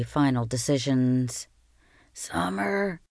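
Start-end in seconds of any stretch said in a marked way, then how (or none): 2.1-3.0 faint, feeble voice; weak voice. Acted. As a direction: say it weakly, barely there. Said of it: "I heard him say in a weak voice".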